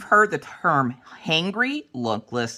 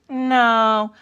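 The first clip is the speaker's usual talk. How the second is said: put-on high, drawn kid "no"; high-pitched little-kid voice; son's refusal; 7 semitones higher than usual. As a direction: imitate a child refusing. Voices son refusing food offers.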